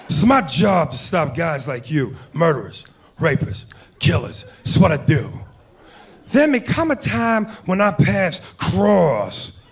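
a Pacino cop warning a criminal; Al Pacino impression. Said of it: "doing Al Pacino".

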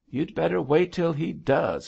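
high, mildly indignant retort; flashing out. A narrator's reading. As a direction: flare up at her father. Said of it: "flashed out Belle".

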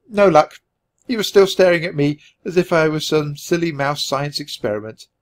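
usual speaking voice

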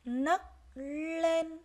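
"Nấc lên" loud and high, held N, held L; careful N and L. Shown as a demonstration. Teacher modelling "Nấc lên" for pupils, distinct N then L. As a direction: separate "Nấc" and "lên", leaning on each first consonant. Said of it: "các em lưu ý tiếng nấc".

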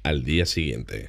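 low, slow, soft narrator caption; rótulo de narrador. Acted. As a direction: drop low and draw it out softly. Reads a scene-change caption. (voz de narrador grave y pausada)

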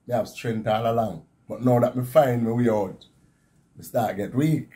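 ordinary delivery

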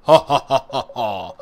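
big, booming emperor's laugh. Acted. Player redoing the laugh in character as the Emperor, loud imperial laughter. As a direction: laugh loudly, like a mighty emperor. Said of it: "I'll do that in my Emperor voice".

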